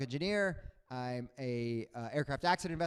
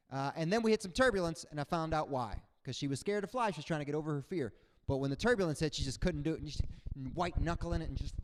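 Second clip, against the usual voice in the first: ordinary talk, nothing marked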